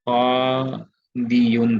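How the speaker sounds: कादियुन stretched very slowly, qaa... di-yun; underlying full form. Shown as a demonstration. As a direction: draw out each syllable of कादियुन. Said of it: "कादिन actually में क्या था?". (कादियुन को खींचकर, पूरा उच्चारण)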